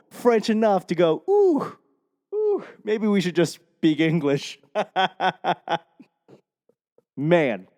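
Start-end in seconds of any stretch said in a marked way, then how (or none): 1.3-4.6 high wincing oohs, then gentle advice; cringing. Acted. Hearing own French played back, cringing. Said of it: "I'm cringing". 4.7-5.8 amused laugh. Real, not acted. Laughing at own bad French, amused chuckle.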